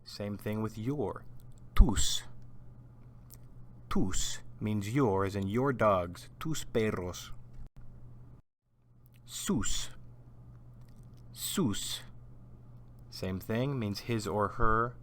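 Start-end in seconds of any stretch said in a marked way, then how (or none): 1.7-2.2 Tus said alone with a clear s, louder; Spanish tus, louder. Shown as a demonstration. Teacher models Tus for students to repeat. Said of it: "repeat after me". 9.2-12.0 Sus said alone with a clear s; Spanish sus. Shown as a demonstration. Teacher says Sus for students to repeat. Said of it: "repeat after me".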